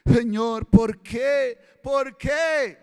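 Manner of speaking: loud accusing "¿por qué?", pushed hard; reproachful demand. Retold or imitated. Believer reproaching God, loud accusing cry. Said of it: "a veces le reclamamos a Dios".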